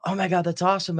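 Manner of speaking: high-pitched thrilled reaction; retold delight, voice lifted high; delighted gushing. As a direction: gush like it's wonderful news.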